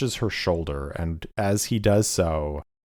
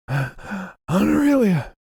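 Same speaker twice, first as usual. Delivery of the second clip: frantic call, high; 12 semitones higher than usual. Acted. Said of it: "and he's like".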